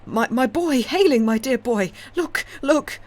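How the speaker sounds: high, loud desperate cries; wild panic. A narrator's reading. Father showing the stranger his drowning son, wild panic. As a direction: point and scream at the drowning boy. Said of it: "said the old man wildly".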